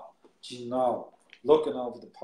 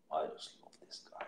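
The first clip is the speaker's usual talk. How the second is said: normal talking voice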